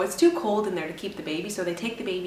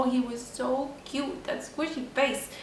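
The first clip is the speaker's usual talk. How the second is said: tender cooing; 5 semitones higher, slower than usual. Real, not acted. Cooing over her newborn.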